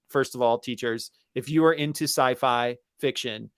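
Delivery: ordinary talk, nothing marked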